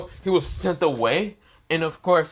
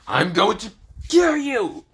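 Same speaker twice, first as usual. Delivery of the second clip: villain threat; 8 semitones higher, 4 dB louder than usual. Acted. Frieza threatening Issei.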